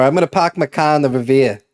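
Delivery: quick Massachusetts accent, R's dropped; brisk Boston-area accent. Shown as a demonstration. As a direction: drop the R's like a Massachusetts local. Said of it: "my Massachusetts accent might come out".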